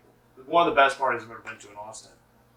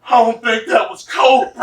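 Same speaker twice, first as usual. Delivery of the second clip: alarmed quote; 10 semitones higher, 12 dB louder, slower than usual. Retold or imitated. Friend panicking on ketamine.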